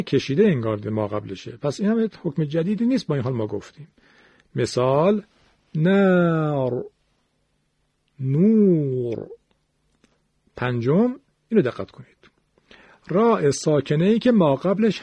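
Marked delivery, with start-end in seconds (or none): 5.7-9.3 very slow نار، نور with stretched vowels and thick final ر; long vowel, heavy R. Shown as a demonstration. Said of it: "واو مدی باشه". (نار، نور با مد کشیده و رای درشت)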